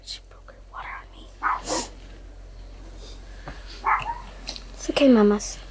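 soft whisper by the dog. Real, not acted. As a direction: keep it hushed.

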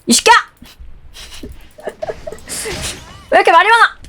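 mock scolding. Scolds a complaining teammate in a loud mock yell. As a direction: playfully bark at the whiner.

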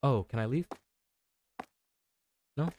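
ordinary talk, nothing marked

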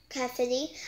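ordinary delivery, nothing marked

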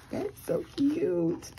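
high, slow gushing squeal; excited squeal. Real, not acted. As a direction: squeal over how cute it is. Gushing over a handmade gift.

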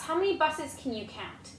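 plain reading voice

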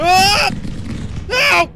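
panicked high scream. Real, not acted. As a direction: scream in real fright. Falls to spider, screams.

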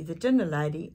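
ordinary delivery

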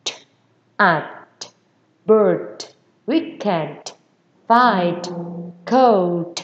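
each word closed with a released puff of T; airy final T. Shown as a demonstration. Teacher drilling final sounds, airy released T. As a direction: puff out the T in "at, bird, weekend, fight, coat". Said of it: "âm là âm gió nha".

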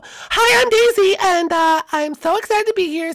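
loud, high, excited introduction; bubbly, high-energy hello. Acted. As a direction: greet the whole crowd with big excitement. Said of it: "You're a little more excited".

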